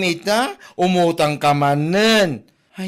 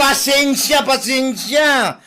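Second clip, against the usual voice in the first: mocking apology; 5 semitones higher, slower than usual.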